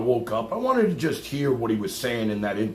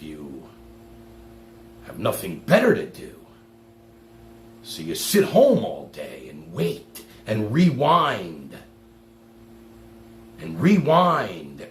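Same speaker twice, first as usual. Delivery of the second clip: slow sarcastic drag; 4 semitones lower, slower than usual. Real, not acted. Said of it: "mocking slow rewind".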